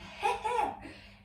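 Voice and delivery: high, breathy burst of laughter; real laugh while counting reps aloud. Real, not acted. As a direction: laugh mid-rep.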